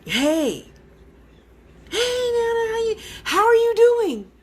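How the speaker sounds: high, crying spirit voice calling out; a ghostly high wail of a greeting; imitating Nana's spirit voice. Spirit greeting the grandmother in a high wail. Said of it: "she's like".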